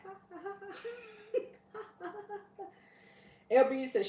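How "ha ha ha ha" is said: high, slow, secret triumphant giggle; sneaky giggle of victory; gleeful hidden laugh. Retold or imitated. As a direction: laugh softly with your back turned. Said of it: "I'll be like".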